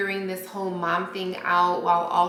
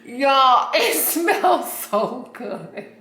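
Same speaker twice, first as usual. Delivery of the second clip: louder laugh through slow words; laughing delight; 4 semitones higher, 4 dB louder, slower than usual. Real, not acted. Smelling the candle, laughing with delight. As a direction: laugh as you savour the scent.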